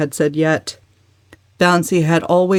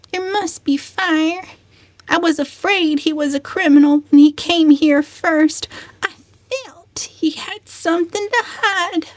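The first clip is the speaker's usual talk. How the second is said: cousin Georgiana, fearful; 11 semitones higher than usual. A narrator's reading. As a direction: fret over the stranger. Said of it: "Georgiana voiced by narrator".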